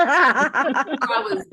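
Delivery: laughing through the words. Real, not acted. Admitting to the panel she lost her cool, while laughing. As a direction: laugh at your own failure to stay cool.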